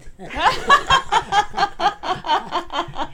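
big real laugh, high and loud. Real, not acted. Mother laughing when asked how she met her husband, loud high laugh. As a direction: burst out laughing at the question.

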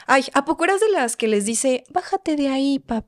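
scoffing at gentle parenting in a lady's voice; mocking older lady. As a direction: tease like a skeptical grandma.

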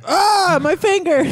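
high, loud fake ouch; mock pain yell. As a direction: yelp as if the finger's cut. Mock pain from finger cutter.

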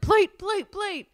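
high, urgent repeated command; mock frantic. Contestants out of time on Chopped, mock frantic. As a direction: imitate a panicked rush to finish.

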